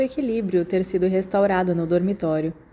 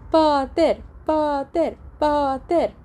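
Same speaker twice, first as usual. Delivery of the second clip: crowd shouting; 7 semitones higher, much slower than usual. A narrator's reading. Crowd cheering James after his landing, shouted chant. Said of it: "agora gritava".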